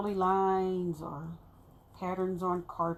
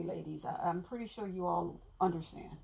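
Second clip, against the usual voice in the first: normal talking voice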